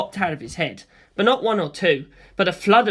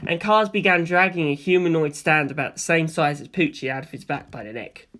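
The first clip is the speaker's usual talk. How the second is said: usual speaking voice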